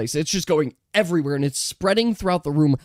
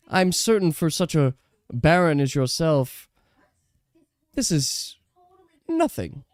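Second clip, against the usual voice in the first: lightly voiced lady, sly and dismissive; condescending. Lady tells the baron the sum is nothing, sneering. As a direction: brush it off with a sneer.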